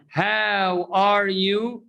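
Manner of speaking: "How are you" very slow, each word separate, "are" in full; wrong unlinked version. Shown as a demonstration. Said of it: "it's not pronounced".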